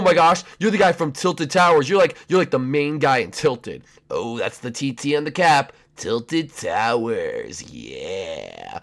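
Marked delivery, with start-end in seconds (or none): 6.0-8.8 low slow character voice. Acted. Skin character voiced by creator.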